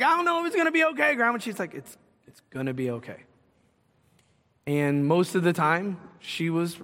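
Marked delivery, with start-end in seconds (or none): 0.0-1.4 high, fast worried protest; retold fretful grandchild, pitched way up; doubtful protest. Doubting grandchild answers grandma's reassurance. 1.7-3.2 low, soft grandmotherly reassurance; retold quiet, unshaken comfort; calm reassurance. Grandma calmly reassures doubting grandchild.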